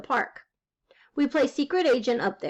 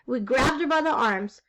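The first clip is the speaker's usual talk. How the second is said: ordinary delivery, nothing marked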